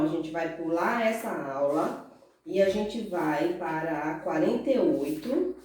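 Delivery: ordinary delivery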